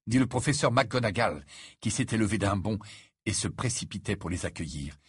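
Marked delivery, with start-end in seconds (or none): none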